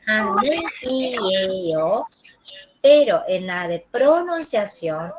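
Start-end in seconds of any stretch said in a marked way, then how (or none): 0.0-2.0 하늘이에요 in separate written blocks, haneul, i, e, yo. Shown as a demonstration. Teacher showing students how it is written, block by block. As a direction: spell out 하늘이에요 with the L kept before "i". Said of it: "se escribe".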